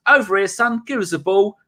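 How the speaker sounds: high, cheeky call for the ball; lightly voiced striker shouting; a hint of a cocky striker; mock Vardy. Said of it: "Vardy goes".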